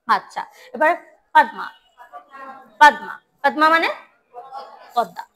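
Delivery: usual speaking voice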